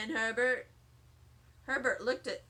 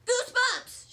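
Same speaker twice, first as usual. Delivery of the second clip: sudden high loud shout; 10 semitones higher, 6 dB louder than usual. A narrator's reading. Sheldon shouting to Lucille that he has goosebumps, excited high shout. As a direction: burst out with sheer delight. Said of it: "shouted Sheldon".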